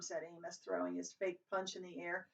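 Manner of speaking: usual speaking voice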